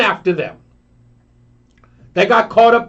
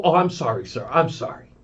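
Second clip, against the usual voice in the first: sheepish apology; 7 semitones lower, 8 dB quieter than usual. Retold or imitated. Steward apologising to passenger.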